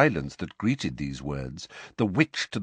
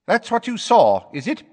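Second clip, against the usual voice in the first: sharp, high, louder challenge; aggressive; 13 semitones higher, 7 dB louder than usual. A narrator's reading. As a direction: jab the question at her. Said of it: "rather aggressively".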